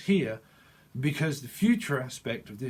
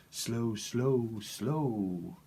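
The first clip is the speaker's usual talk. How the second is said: drawn-out slow; much slower than usual. Shown as a demonstration. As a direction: stretch each word lazily. Acting out being too slow.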